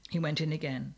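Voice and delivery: plain reading voice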